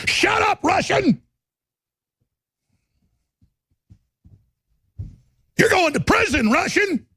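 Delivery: hammed-up heckler shouting at him; mock street heckler shout. Stranger on the street accusing him, high mock yell. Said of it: "somebody probably walk up on the street and go".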